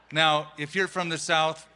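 ordinary talk, nothing marked

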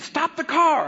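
sudden high, loud order to the driver; urgent command. Retold or imitated. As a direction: imitate a wife urgently calling a halt. Wife's sudden urgent command, retold.

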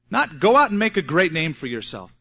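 usual speaking voice

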